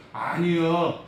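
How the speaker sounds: normal talking voice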